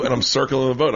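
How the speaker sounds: ordinary delivery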